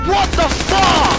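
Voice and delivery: high, loud, drawn-out curse; incredulous outburst. Real, not acted. Gamer shocked by the game, incredulous yell. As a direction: yell it slowly in disbelief.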